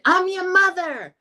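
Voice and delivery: high, slow, drawn-out mom voice; hammed-up mother declaring herself; mock mom voice. Amy tells the girls she's their mother, in a high, drawn-out voice. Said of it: "She said".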